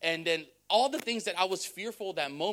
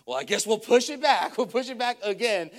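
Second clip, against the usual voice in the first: resigned mock sigh; 5 semitones higher, 10 dB louder than usual. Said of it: "He's like".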